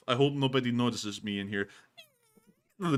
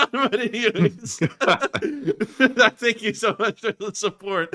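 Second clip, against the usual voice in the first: higher, louder laughing through thanks; 10 semitones higher, 8 dB louder than usual. Real, not acted. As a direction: still cracking up from the skit. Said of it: "laughs after skit".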